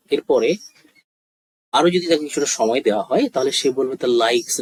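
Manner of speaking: ordinary talk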